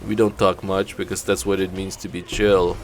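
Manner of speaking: slow, monotone attempt at a New York accent gone Austrian; Arnold Schwarzenegger accent. Streamer welcoming viewers to his chill stream, mock Schwarzenegger accent. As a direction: drone a laid-back welcome in a muddled Schwarzenegger-like accent. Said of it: "what I thought was a New York accent, but turns out it's an Arnold Schwarzenegger accent".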